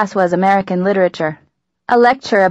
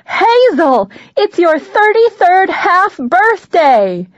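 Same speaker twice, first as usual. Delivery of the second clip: high, loud, drawn-out exclamation; excited yell; 11 semitones higher, 5 dB louder, much slower than usual. Acted. Mom announcing daughter's half birthday, excited yell. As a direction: belt out the big news, stretching each word. Mom screaming back the big news.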